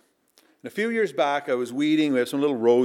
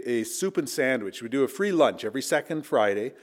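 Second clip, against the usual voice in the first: ordinary talk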